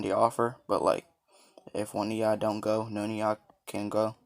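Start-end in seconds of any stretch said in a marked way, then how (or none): none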